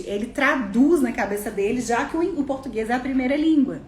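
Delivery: ordinary talk, nothing marked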